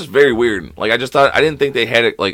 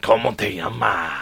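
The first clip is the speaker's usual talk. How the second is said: put-on slow, softer Mexican-accented drawl; 2 semitones lower, 7 dB quieter, slower than usual. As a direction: stretch it out in a mock Mexican accent. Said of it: "that was stereotypical, like, Mexican dude".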